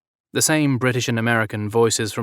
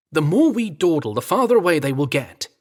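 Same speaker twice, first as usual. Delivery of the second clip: urgent butler voice; 6 semitones higher, 5 dB louder than usual. A narrator's reading. Staff man urging haste.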